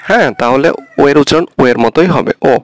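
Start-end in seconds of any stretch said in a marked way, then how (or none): none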